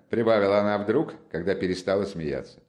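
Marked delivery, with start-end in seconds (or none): none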